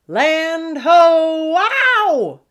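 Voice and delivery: loud, high, drawn-out holler; full-voiced call soaring into a wail; flying lookout yell. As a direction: yell it while sailing through the air. Second pug launched through air.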